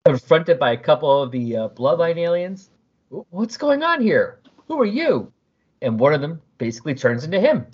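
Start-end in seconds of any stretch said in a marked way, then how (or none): 3.1-5.3 startled Smee. Acted. Smee confronted by aliens, startled and stammering. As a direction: stumble out a frightened question.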